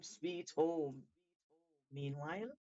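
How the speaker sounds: plain reading voice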